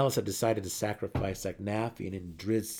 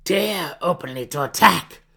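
a scream rising higher and much louder as she goes; 7 semitones higher, 10 dB louder than usual. Acted. Matron mother screaming at her daughter amid the attack, furious. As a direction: fling it out as a furious screamed denial. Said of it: "Malice screamed".

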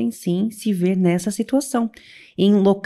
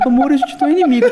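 higher, louder, half-laughing imitation of her husband; mock tender husband; 6 semitones higher, 5 dB louder, faster than usual. Wife mimicking her husband's calm line, teasing and laughing. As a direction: ham up his soulful line while giggling. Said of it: "Imagina o Leandro".

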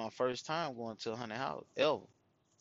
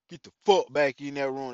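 louder barked order in a put-on voice; loud command; 4 semitones higher, 9 dB louder than usual. The host orders the woman back to her room, loud. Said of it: "He be like".

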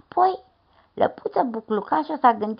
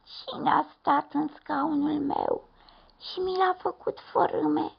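put-on high, slow, thin baby bear; tiny bear asking in a high thin voice; thin little bear voice; 9 semitones higher than usual. A narrator's reading. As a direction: squeak it out like the smallest bear. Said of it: "a spus cu o voce subțire".

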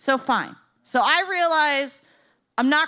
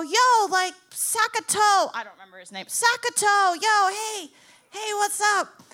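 calling out; 8 semitones higher than usual. Retold or imitated. Calling out to coworker from car.

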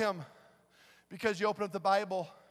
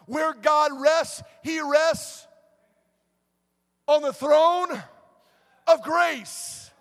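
high, loud, relentless sermon delivery; full-force preaching; 8 semitones higher, 10 dB louder than usual. Real, not acted. Preacher contrasting judgment with grace to congregation, full-force preaching. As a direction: preach grace at a driving shout.

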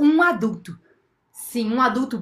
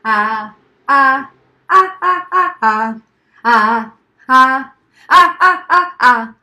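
loud chanted "ah" bursts; rhythmic loud scream; 9 dB louder, much slower than usual. A narrator's reading. Girl screaming for her friends to hear, rhythmic scream. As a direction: punch out short sung yells, stepping up in pitch. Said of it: "E ela gritou".

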